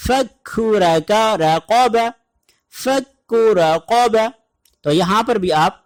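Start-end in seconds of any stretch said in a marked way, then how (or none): 0.1-4.2 slow Quranic recitation of فکو رقاب; thin kaf, thick qaf. Shown as a demonstration. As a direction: contrast the light kaf with the heavy qaf slowly. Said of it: "پہلے کاف باریک ہے اور دوسرا کاف یہاں پر موٹا".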